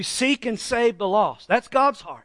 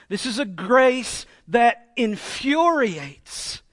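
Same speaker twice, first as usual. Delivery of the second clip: usual speaking voice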